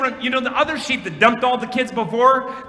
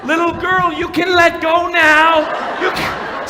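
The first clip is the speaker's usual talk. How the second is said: calling out; 8 semitones higher, 4 dB louder, slower than usual. Retold or imitated. Clowns calling to girl on sheep.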